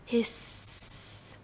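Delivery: soft, long trailing sibilant; snake hiss. Shown as a demonstration. Teacher demonstrating animal sounds, long soft snake hiss. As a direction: stretch the final S like a snake. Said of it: "Animal sounds".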